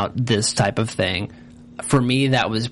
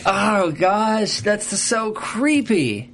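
creeped out; 8 semitones higher, much slower than usual. Real, not acted. Reacting to creepy scene.

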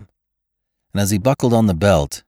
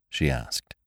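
plain reading voice

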